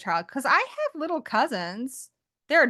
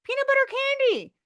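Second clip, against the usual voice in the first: high, bright-eyed enthusiasm; rising with delight; excited; 3 dB louder than usual. Real, not acted. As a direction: gush about the candy. Excited about favorite treat.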